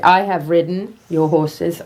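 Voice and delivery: normal talking voice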